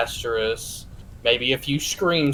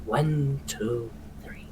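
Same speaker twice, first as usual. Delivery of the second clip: counting under his breath; 10 dB quieter, much slower than usual. Acted. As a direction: count quietly to yourself. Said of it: "You hear him counting under his breath".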